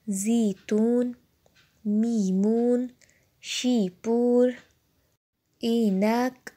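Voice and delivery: each word drawn out slowly with a long clear ee; Persian ee vowel. Shown as a demonstration. Persian teacher sounding out yeh words for students, slow clear ee. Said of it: "الف یا زیر ای".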